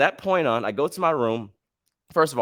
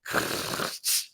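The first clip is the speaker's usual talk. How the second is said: long rattle ending in a clunk; imitated elevator noise; 6 dB quieter than usual. Describing an old-fashioned elevator, imitated mechanical noise. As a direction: mimic an old gate grinding shut. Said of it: "the one that goes".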